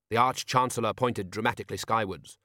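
ordinary delivery, nothing marked

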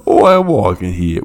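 hammed-up New Yorker accent. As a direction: toss it off like a New York cabbie. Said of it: "the New Yorker".

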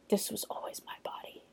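very soft, whispered declaration; hushed, acted-out triumph; dramatic whisper. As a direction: whisper it like a movie heroine's big line. Heroine's triumphant line, retold.

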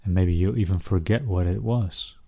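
ordinary delivery

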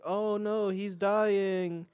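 high, hammed-up fake dismay; mock alarm. Acted fake alarm over Lenin.

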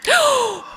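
real, high gasp of surprise; sharp intake of breath in astonishment; shocked gasp. Real, not acted. Co-host learns who the actor's daughter is, shocked gasp. Reacting to surprising family revelation.

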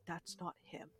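low, barely audible warning; hushed. Retold or imitated. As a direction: breathe it out almost silently. Mute neighbor finally speaks.

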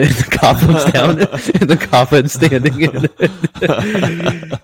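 talking through laughter; can barely get the words out laughing. Real, not acted. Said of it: "So I freaking start laughing".

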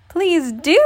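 usual speaking voice

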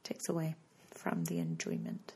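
soft low whisper; whispered aside. Real, not acted. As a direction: mutter it under your breath. Muttered aside after criticism.

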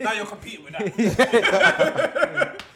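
loud, slow, laughing line. Real, not acted. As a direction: drag it out, cracking up. Joking, laughing.

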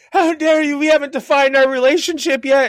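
high, loud, hammed-up indignation; mock outrage. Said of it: "fucking blow up at him".

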